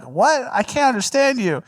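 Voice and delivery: very high mock bewilderment; puzzled restaurant worker. Pranked staff to the gibberish caller, mock bafflement. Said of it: "and then they would be like".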